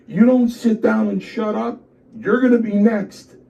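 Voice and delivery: high, loud warning from the boss; retold angry threat. Chief threatens wrestler, loud high-pitched scolding. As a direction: act out shutting down an argument.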